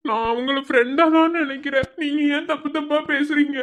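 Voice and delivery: a nervous junior begging the senior; pleading timid student. Acted. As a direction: plead meekly to get the letter back.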